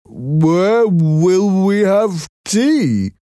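high, slow, gentle-mannered Merrick voice; timid deformed man, high and slow; Merrick's voice. A narrator's reading.

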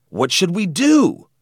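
high, loud, worried appeal; anxious question. A narrator's reading. Boy asking friends after the threat, anxious. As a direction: pitch it up in alarm. Scared boys confer in bedroom.

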